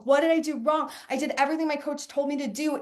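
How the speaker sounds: usual speaking voice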